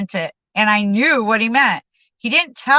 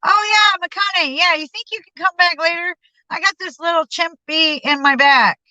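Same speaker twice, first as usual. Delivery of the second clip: much higher, lazy put-on voice for Don; Don's voice; 9 semitones higher than usual. Don putting him off from behind the door, high put-on voice. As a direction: play the friend stalling behind the door.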